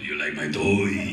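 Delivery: slow, loud, very low voice; Andre. Retold or imitated. Giant showing off his ride to a co-star, deep and slow. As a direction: rumble it out slowly like a giant. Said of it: "He goes".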